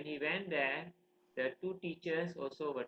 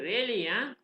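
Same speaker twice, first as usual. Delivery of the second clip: raised, loud mock disbelief; menacing challenge; 6 semitones higher, 6 dB louder than usual. Father answering his defiant son, challenging. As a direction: throw it back as a challenge.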